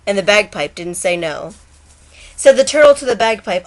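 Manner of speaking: sing-song storytelling read; poem recitation. A narrator's reading. Vlogger reading Shel Silverstein to viewers, rhythmic recitation. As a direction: read it like a bedtime rhyme.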